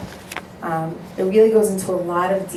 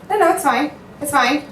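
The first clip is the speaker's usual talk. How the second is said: forced brush-off; 7 semitones higher than usual. Retold or imitated. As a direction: imitate her forcing it's fine. Upset girl insists she's fine.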